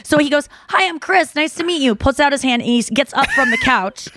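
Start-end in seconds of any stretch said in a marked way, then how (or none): 0.7-1.9 higher-pitched retold polite greeting; polite, sweet. Boyfriend politely introducing himself and offering his hand, sweet and polite. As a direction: imitate a courteous introduction. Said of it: "he's, like, so polite".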